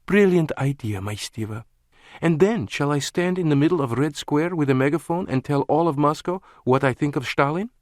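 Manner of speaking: mock him like a silly child; sarcastic woman. A narrator's reading. Lana, laughing sarcasm.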